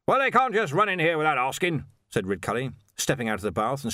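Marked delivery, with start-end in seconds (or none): 0.1-1.8 high, blustering authority figure; indignant Arch-Chancellor. A narrator's reading. Arch-Chancellor objects to police in his library, indignant. Indignant wizard voiced in story.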